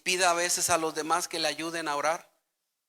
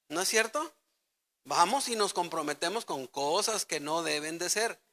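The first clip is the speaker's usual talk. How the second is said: ordinary delivery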